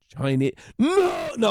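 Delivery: loud, high yelp of no; mock refusal. Comedian refusing the Chinese tour group, loud and high. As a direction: blurt the no in comic panic.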